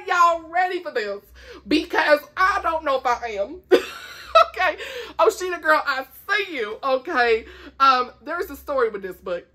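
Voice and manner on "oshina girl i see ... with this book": usual speaking voice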